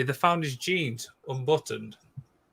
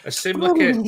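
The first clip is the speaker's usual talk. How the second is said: high squeaky voice; 6 dB louder, faster than usual. Pretend. Mock dramatic announcement.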